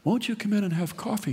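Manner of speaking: high, quick hostess invitation; an old lady playing the perfect hostess; gracious old hostess. Old mother inviting her son in, high and gracious.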